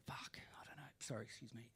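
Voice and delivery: real whispered curse under the breath; soft whispered aside. Real, not acted. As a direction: mutter it to yourself. Muttered aside mid-story.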